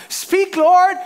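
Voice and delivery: loud, high mock-fervent plea; eager prayer. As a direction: call up to God with hammed-up eagerness. Acting eager believer praying to God.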